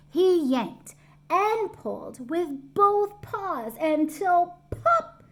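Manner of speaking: building slow effort; dramatic narration. A narrator's reading. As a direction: stretch it out like a hard pull.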